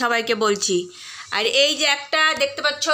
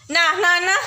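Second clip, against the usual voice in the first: usual speaking voice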